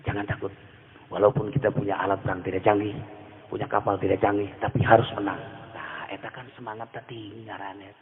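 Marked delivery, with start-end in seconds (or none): 0.0-5.4 lightly voiced calm Gus Dur, low and soft. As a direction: lower and softer, a calm old kyai reassuring. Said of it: "Eta Gus Dur hebat ya".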